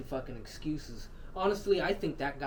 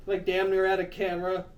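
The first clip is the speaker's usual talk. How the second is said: usual speaking voice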